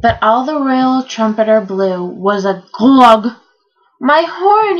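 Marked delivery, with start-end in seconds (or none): 4.0-4.8 high, dismayed cry; mild gulp of alarm, pitched up. A narrator's reading. Trumpeter finding his horn blocked, gulping. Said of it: "he gulped".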